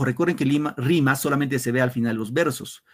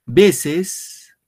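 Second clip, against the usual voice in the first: higher and louder "Veces", stressed on "Ve"; announced loudly; 5 semitones higher, 8 dB louder than usual. Shown as a demonstration. Teacher announcing the next word to pupils, loud. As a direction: call out "Veces" to the class. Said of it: "Ticia y ticia por acá".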